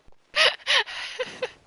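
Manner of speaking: loud high cackling laugh; high burst of laughter. Real, not acted. Streamer laughing hard at the game, high loud laugh.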